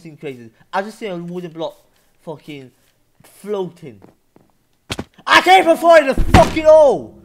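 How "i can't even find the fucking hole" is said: very high, very loud yell; frustrated screaming. Real, not acted. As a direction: scream it, totally exasperated. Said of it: "you're just screaming".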